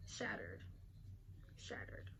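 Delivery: breathy hushed whisper; dramatic whisper. Real, not acted. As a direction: whisper it like your heart just broke. Dramatic end of her hopes.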